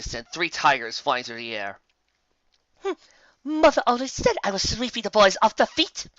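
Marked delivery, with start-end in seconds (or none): 2.8-6.1 high, airy society lady voice; high lady with a chuckle; posh chuckling mare. Acted. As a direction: purr it like a flirt. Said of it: "she said with a chuckle".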